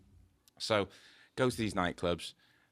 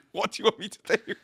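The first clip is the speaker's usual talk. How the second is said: much higher and louder, laughing through the words; baffled on stage, giggle out the question; laughing helplessness; 13 semitones higher, 8 dB louder than usual. Retold or imitated. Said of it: "I'm stood there going".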